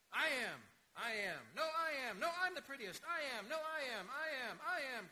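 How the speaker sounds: a crowd of cats shouting over each other; clamoring cats. Acted. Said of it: "cried hundreds and thousands ... of voices".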